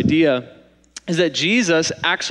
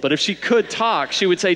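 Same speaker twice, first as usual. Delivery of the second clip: usual speaking voice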